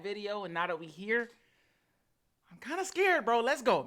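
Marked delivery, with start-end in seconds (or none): 2.5-3.9 high, louder burst of nerves; nervously scared. Real, not acted. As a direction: psych yourself up despite the jitters.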